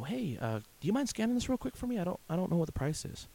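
high, sweet asking voice; polite customer asking a favor; overly polite request. Retold or imitated. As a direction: ask nicely, a bit too nicely. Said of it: "and I go".

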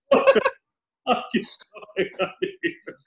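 a yelped WHAT then the rest breathed out unvoiced; shocked disbelief, sharp then hushed; incredulous yelp fading into a whisper; stunned outburst. His own retold shocked reaction.

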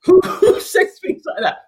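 high disbelieving laugh through the words; incredulous laugh. Real, not acted.

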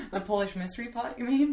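high, loud laughing question. Real, not acted. Host laughing as the guest claims her Polish pot.

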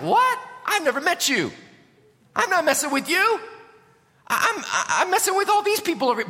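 high, startled protest; surprised Saul. Retold or imitated. Saul protests to Jesus, high and startled. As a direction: play Saul baffled and defensive. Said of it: "he's like".

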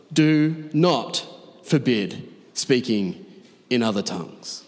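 slow "Do not forbid", pausing between words, falling away; deliberate emphasis. Real, not acted. Preacher citing verse thirty-nine against bans, slow separated DO NOT FORBID.

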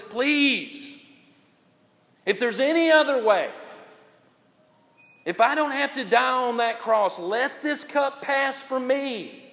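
Jesus praying in the garden; pleading Jesus. Acted. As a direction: plead for another way. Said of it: "He says, and he's begging God".

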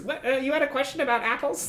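high, quick little creature voice; chirpy sidekick creature; Gerby voice. Acted. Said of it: "Gerby says".

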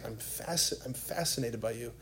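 soft, low, intimate declaration; hushed and sincere; tender reverence. Retold or imitated. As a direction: say it gently, as God to a beloved. Voices the Lord's tender words.

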